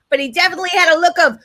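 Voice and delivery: ordinary delivery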